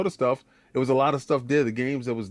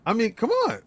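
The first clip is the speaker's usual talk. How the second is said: commercial voice; 8 semitones higher than usual. Acted. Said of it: "Stop hitting me with the commercial voice".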